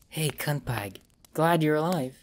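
soft, rough-edged female character voice; quiet rough voice of a young woman; Rosie's voice. A narrator's reading. Rosie speaks in captivity.

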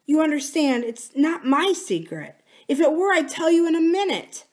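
high, earnest explanation; lightly voiced pleading wife. Wife guarding someone else's secret, lightly pleading.

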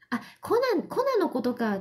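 mock Kansai reply about 粉; a light Kansai voice; imitating Kansai speaker. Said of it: "粉の話をして".

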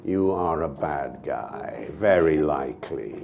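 slow, low RP villain drawl; posh Bond villain. Pretend. Villain greeting Mister Bond, slow and low posh menace.

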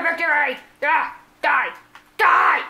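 loud bursts of gamer aggression; shouted attack cries; battle cry. Real, not acted. Battle cries during game.

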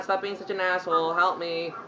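ordinary delivery

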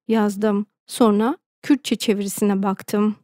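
plain reading voice

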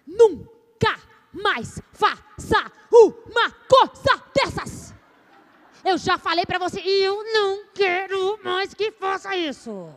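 high, loud, slow scolding, word by word; a mother chopping rage into syllables; syllable-by-syllable maternal scolding. Shown as a demonstration. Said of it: "a mãe silábica".

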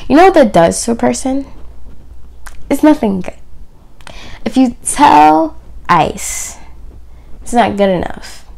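ordinary talk, nothing marked

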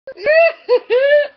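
very high, loud giggling squeal; squealing laughter. Real, not acted. Laughing at the pets' antics.

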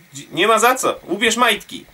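normal talking voice

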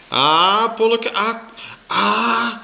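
high, drawn-out whine; zeurend. A narrator's reading. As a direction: whine and plead with a child. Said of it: "zeurde ze". (zeurderig smekend)

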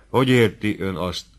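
usual speaking voice

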